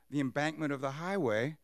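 ordinary talk

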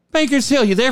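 high on-air call; mock radio host. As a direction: greet the caller on air. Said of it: "Ted on air".

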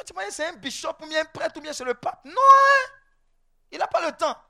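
high-pitched scoffing, big "non"; high, incredulous put-down; mocking dismissal. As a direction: scoff at leaving it to clergy. Dismisses waiting for clergy to help.

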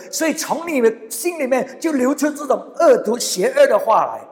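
high, loud preaching of the rebuke; fervent preaching. Acted. Preacher warning the congregation, fervent and loud. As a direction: preach it with rising force. Preaching at full force in Chinese.

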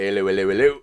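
mock copper's catchphrase; hammed-up bobby greeting; policeman impression. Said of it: "Good impression of a policeman".

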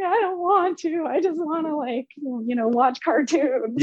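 put-on high, drawn-out childish reluctance; mock whiny self-imitation; nervous whining. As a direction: plead like a timid little kid. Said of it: "I'm, like, nervous".

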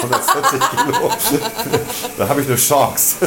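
mitten im Lachen gesprochen; lachend. Real, not acted. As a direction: lach dich durch den eigenen Witz, hohe Stimme. Witz übers Gewicht, alle lachen. (lachend herausgebracht)

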